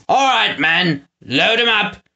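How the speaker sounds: calling out orders. A narrator's reading. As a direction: bark it across the factory. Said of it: "Snatcher called".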